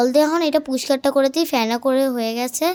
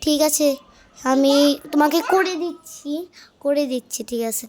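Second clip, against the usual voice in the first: ordinary delivery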